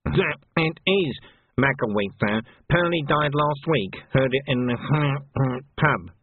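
slow underling with a snuffling hitch; nervous Snouty. Acted. Snouty corrects his captain, slow and nervous.

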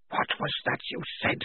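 hushed, voiceless challenge; menacing whisper. Acted. Tonka gone pale at Polly's words, whispering.

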